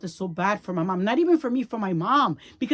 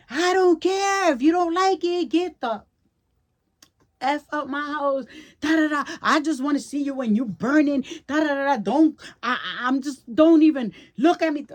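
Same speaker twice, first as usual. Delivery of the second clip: son's angry yell; 6 semitones higher, 5 dB louder than usual. Retold or imitated. Mother reenacting her son's screaming tirade, high furious yell. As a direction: throw his rage back, pitched high and loud.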